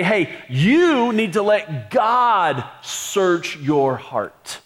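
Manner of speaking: drawn out, dropping at heart; slow gentle urging. Pretend. Paul voiced gently.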